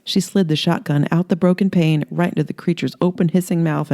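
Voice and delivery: ordinary delivery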